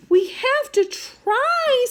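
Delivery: put-on high, slow chorus of kids; determined kids. A narrator's reading. The grasshoppers insisting to keep searching, high and slow. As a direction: insist it together, slowly.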